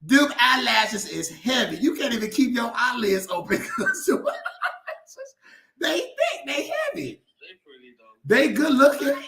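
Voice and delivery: poking fun at sister's lashes; teasing laugh. Real, not acted. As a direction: tease her through laughter. Teasing sister about eyelashes.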